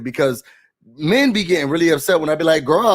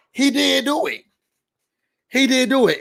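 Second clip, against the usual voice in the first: high, emphatic insistence; high insistent repeat; 9 semitones higher than usual. Real, not acted. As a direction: press the point, up high. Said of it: "repeats insistently".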